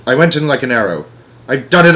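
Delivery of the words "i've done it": high, loud triumphant cry; excited cry. A narrator's reading. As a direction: cry it out, bursting with joy. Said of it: "I cried, jumping up and down".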